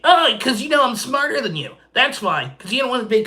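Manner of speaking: high sing-song jeering voice; high snotty mimicry; mocking taunt. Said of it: "And I'm like".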